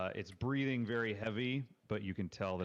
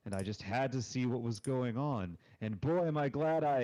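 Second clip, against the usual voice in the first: cheerful bard; 4 semitones higher, 4 dB louder than usual. Acted. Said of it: "she sort of bows gracefully".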